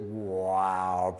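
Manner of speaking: low, impressed wow; drawn-out wonder. Retold or imitated. To a crying child in the game, low wonder. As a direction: stretch it out in hushed amazement.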